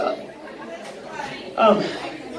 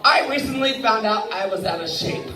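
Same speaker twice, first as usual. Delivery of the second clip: normal talking voice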